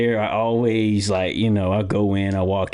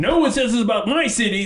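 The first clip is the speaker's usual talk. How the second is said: movie quote; 12 semitones higher than usual. Retold or imitated. Quoting the Anchorman guy defending his city, high and indignant. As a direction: belt it like an indignant newsman. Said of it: "that one guy goes".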